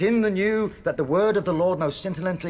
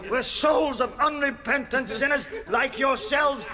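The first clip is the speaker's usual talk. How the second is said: higher, declaiming preacher's voice; hellfire sermon; 5 semitones higher than usual. Acted. Chaplain preaching hellfire to prisoners, fiery sermon. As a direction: thunder out a warning to unrepentant sinners.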